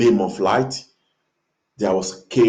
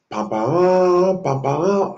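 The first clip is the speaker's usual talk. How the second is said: high, nasal-free mock trumpet call pam pam; trumpet blast imitation; 9 semitones higher, much slower than usual. Telling of angels blowing trumpets, imitating the trumpet blast. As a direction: blare it like a heavenly horn.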